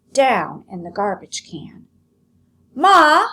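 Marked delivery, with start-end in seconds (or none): 2.8-3.3 loud, high call; shouting. Acted. Lolly shouting to her mother from the kitchen, loud and high. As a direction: shout across the house. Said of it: "Lolly shouted".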